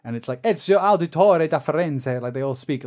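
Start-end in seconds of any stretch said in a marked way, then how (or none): 0.4-2.2 put-on lively Italian accent, higher and louder; theatrical Italian-accented introduction. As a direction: announce the name with Italian flourish. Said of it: "with this Italian accent".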